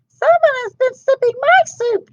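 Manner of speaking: Mama Bear indignant at her soup; growling Mama Bear. Acted. Mama Bear finds sloshed soup, high mock growl. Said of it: "she growled".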